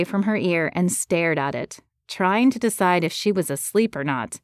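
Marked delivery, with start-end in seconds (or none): none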